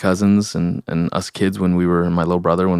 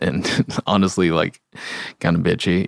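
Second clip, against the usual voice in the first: usual speaking voice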